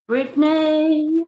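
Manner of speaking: high, slow drawn-out call of her name; sing-song call. Real, not acted. Streamer calling for a viewer by name, high and drawn out. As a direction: call Brittany like you're looking for her.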